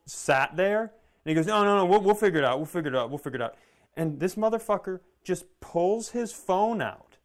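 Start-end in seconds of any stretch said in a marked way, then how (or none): 1.5-3.5 mock flustered reassurance. Employee's flustered reassurance, retold.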